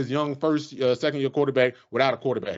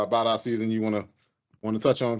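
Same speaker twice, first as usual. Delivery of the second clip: ordinary delivery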